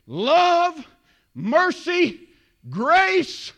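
emphatic, high-pitched pulpit delivery; impassioned preaching. Real, not acted. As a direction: build steadily, then punch out each virtue separately with pauses. Preaching builds through a list.

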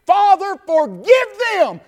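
normal talking voice

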